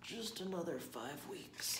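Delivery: slow, drowsy mild plea; lightly voiced sleepy bear; begging. A narrator's reading. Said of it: "he begged".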